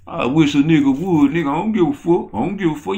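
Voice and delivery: high, sassy mimic of a woman. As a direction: toss it off like you don't care. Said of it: "sound like a lady".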